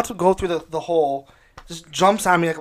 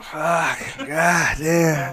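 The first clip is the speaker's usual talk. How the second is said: a mumbled, groaning curse; demo of his mumbling; much slower than usual. Shown as a demonstration. Showing how he mutters when the game goes wrong. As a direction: grumble it half under breath. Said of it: "I just do that".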